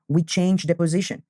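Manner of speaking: ordinary talk, nothing marked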